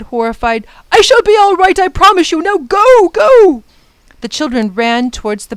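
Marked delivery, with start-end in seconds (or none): 0.9-1.8 shall loud and high over the noise; I shall be all right, shouted urgently; shouted reassurance. Acted. 1.8-3.6 loud high urging; urgent shouting. Acted. Urging children to flee.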